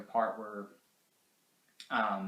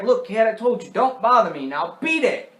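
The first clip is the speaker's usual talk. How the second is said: loud, raised mock-annoyed scolding; irritated brush-off; 9 semitones higher, 9 dB louder than usual. Driver to the bothersome kid, annoyed dismissal. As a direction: tell a pest to clear off.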